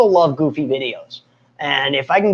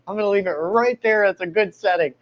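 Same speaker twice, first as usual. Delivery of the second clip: ordinary delivery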